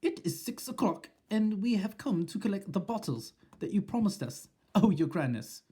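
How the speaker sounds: high, timid witch voice; meek voice. Acted. Witches at the door addressing their leader meekly. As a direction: speak up nervously. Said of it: "said a meek voice".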